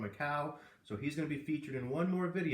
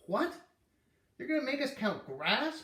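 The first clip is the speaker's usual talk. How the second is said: yelled; 11 semitones higher than usual. A narrator's reading. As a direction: yell it in outrage like a kid. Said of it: "Peter yelled".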